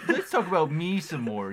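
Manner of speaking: loud, high teasing through a laugh; mock self-absorbed. Co-host teasing the one who said back to me, mock self-absorbed.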